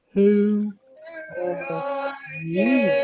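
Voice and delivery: loud, drawn-out gruff voice; gruff ram. Shown as a demonstration. Teacher leading children reading Ram's line, gruff and drawn out. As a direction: push out each word like a big ram. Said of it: "Said Ram in a gruff voice".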